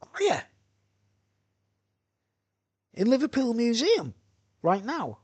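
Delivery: high-pitched questioning imitation; mimicked woman's high voice on the phone; imitating ex-girlfriend. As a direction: play her pitched well up, querying. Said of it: "And she goes".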